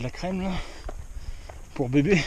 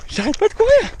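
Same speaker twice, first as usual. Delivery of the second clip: excited; 6 dB louder than usual. Real, not acted. Excited runner on the trail.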